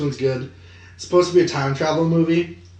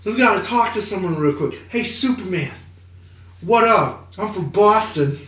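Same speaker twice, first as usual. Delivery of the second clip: Boston guy; 7 semitones higher than usual. Pretend. Acting Wahlberg in Justice League.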